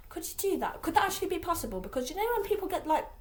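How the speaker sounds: usual speaking voice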